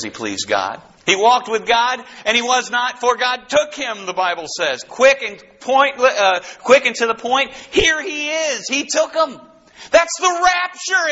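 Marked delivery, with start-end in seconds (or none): 4.8-11.1 excited preaching. Real, not acted. Preacher exclaims the rapture.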